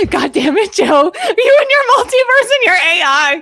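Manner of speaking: half-joking furious scolding; mock exasperated outburst. Waking wife mock-blames her husband Joe, exasperated. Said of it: "And then I was like".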